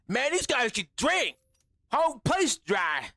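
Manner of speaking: mimicking an interviewee's non-Boston accent; imitated non-Boston accent. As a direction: put on a thick accent that isn't Boston. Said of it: "that was not a Boston accent".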